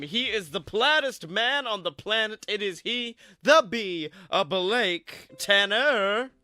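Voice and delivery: building hype announcer intro; host introduction. Acted.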